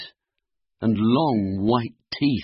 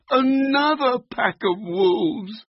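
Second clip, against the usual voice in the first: very high, quavering dread; frightened dismay; 12 semitones higher, 4 dB louder than usual. Acted. Floppy mistakes huskies for wolves, frightened. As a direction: wail it high as a scared little dog.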